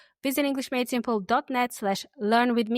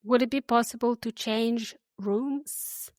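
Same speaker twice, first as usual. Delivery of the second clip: slow, clear model sentence; said slowly. Shown as a demonstration. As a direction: drag it out gently for learners to repeat. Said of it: "I'll say them slowly".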